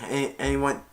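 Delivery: ordinary talk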